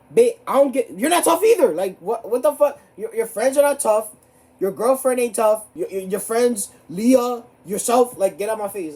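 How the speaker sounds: loud, high, heated comeback; shrill loud tirade at a classmate; indignant rant. Retold or imitated. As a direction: fire it back, fully offended. Rants back at Lewis.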